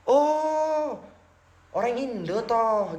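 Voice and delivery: mock surprised realization. Indian worshipper learning the speaker is Indonesian, imitated surprise. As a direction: imitate someone pleasantly taken aback. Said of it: "gitu katanya".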